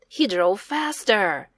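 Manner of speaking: ordinary delivery, nothing marked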